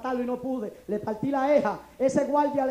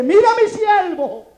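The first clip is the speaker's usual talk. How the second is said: slow, higher and louder proclamation; prophetic declaration; 8 semitones higher, 13 dB louder, slower than usual. Retold or imitated. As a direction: proclaim it over him like a prophet. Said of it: "me pone las manos en la frente me dice".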